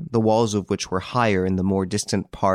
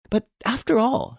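much higher, urgent protest; impatient exclamation. A narrator's reading. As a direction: push back, fed up with his calm. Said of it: "she exclaimed impatiently".

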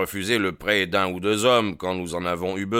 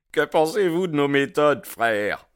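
lodge man's question; 9 semitones higher, 6 dB louder than usual. A narrator's reading. Lodge member voiced.

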